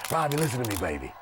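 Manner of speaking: put-on smooth Hollywood agent, dropping low and soft on baby. Hollywood agent hyping up a basketball player, in a smooth, low voice. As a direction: sweet-talk him like a slick Hollywood agent. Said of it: "we also got the Hollywood guy".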